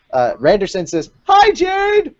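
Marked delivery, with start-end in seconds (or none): 1.3-2.2 high, loud excited hi; much higher, louder bright greeting. Pretend. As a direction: call it out like a thrilled fan. Reading a fan's greeting.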